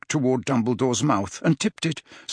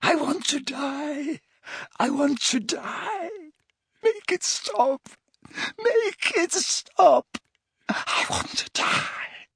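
slow, high, strained moans; thin, drawn-out tortured cries; anguished wailing; 3 dB quieter, slower than usual. A narrator's reading. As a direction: wail in agony, ending nearly voiceless. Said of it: "began to scream in more anguish".

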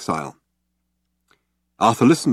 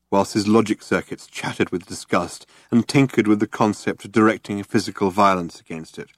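plain reading voice

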